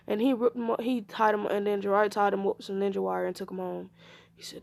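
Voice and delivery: usual speaking voice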